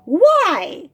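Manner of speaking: high, loud incredulous cry; hammed-up astonished bird; acted disbelief. Bird hears she brought the frog herself, incredulous.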